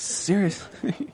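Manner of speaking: slow, whispered word. Real, not acted. Joking with a co-host about unsanitary harvesting, whispered. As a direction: whisper it, drawing it out. Mock outrage at smoking while harvesting.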